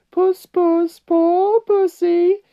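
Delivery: very high, loud falsetto taunt; squealing mock pity, drawn out; jeering. A narrator's reading. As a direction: coo mockingly as if calling a cat. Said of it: "jeering at him, saying things like".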